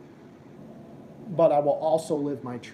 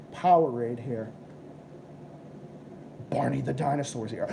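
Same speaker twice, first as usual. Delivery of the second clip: ordinary talk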